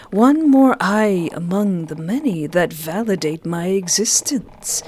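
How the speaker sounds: ordinary talk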